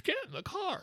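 very high, thin falsetto scream; hammed-up, high-pitched frantic yelling; mock scream. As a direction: scream it in falsetto without full volume. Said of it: "I'm screaming".

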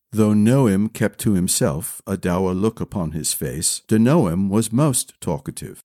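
ordinary delivery

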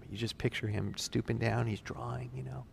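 ordinary talk, nothing marked

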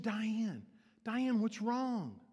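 gently acted concern, slowing down; concerned teacher. Teacher asks the tearful girl, lightly acted concern.

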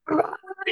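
high-pitched wordless shriek, imitated; imitated high yelp of alarm; mock yell. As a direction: imitate an outraged high screech. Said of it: "she started yelling at her".

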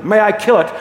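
acted insistent angel; pressing demand. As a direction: press hard for permission, loud and high. Angel presses ghost for permission.

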